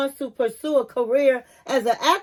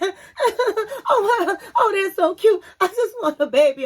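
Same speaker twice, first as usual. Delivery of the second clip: high, tearful laughing gush; choked up, laughing; 8 semitones higher, 3 dB louder than usual. Retold or imitated. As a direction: gush over the babies through happy tears. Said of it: "she's getting all choked up".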